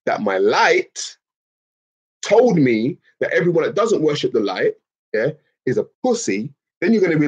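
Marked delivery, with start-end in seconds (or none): none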